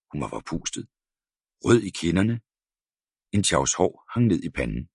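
usual speaking voice

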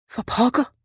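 "For pokker" rising, mild high exclamation; a light gasp of shock on "For pokker"; exclaimed. A narrator's reading. Tom finds the baby in the boat, mild startled exclamation. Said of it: "udbrød han".

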